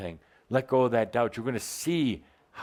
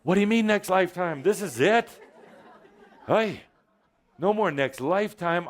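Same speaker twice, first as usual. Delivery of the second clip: mock dismay; 6 semitones higher than usual. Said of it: "I can feel you say".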